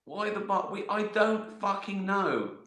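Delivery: mock outrage at an idiot mime; exasperated comic rant. Describer slagging off the mime.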